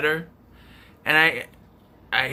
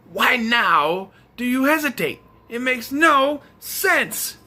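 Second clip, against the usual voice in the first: slow, drawn-out and higher, fed up; exasperated; 7 semitones higher, slower than usual. Real, not acted. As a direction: stretch it out in disbelief. Exasperated over plot hole.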